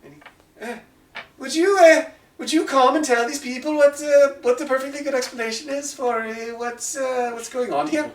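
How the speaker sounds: high, loud call of Oi, then hesitant asking; a big man flustered, calling for backup. Acted. The large man calls the elf over to explain, high and loud. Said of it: "you hear".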